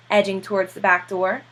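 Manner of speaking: usual speaking voice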